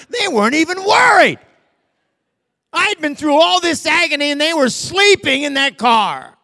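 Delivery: loud, high indignant cry; preaching at full force; outraged shout. Real, not acted. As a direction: bellow the injustice from the pulpit. Preacher's outraged retold grievance.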